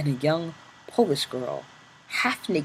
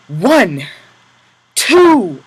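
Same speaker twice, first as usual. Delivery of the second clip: loud high counting; counting lashes loudly; 5 semitones higher, 12 dB louder than usual. Acted. Capo counting the whip lashes aloud, loud and high. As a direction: count each lash out loud. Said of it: "he was counting".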